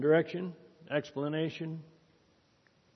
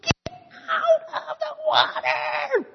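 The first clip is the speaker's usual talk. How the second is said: even higher, louder and slower angry mouse shout; mouse yelling louder; 7 dB louder, slower than usual. A narrator's reading. Angrier mouse repeats his demand to the elephant, shrill slow yell. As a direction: stretch the yell out, fed up and furious. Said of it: "yelled louder".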